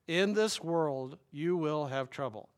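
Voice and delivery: slow, each word weighed; slow emphatic quote. Shown as a demonstration. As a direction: say it slowly for the congregation to follow. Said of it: "Say that with me".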